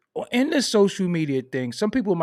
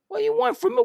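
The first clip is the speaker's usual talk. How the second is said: much higher, lightly voiced desperate hustler; mock pleading; 4 dB louder, much faster than usual. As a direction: whine it in a put-on high voice. Mocking pushy rapper.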